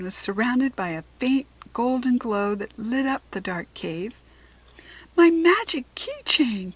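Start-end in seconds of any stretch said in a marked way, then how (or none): 5.2-6.7 slower, higher and louder with delight; thrilled fairy voice; excited. A narrator's reading. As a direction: gasp it out in delight. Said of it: "Kitty said excitedly".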